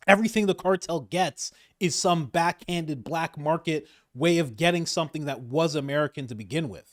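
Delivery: usual speaking voice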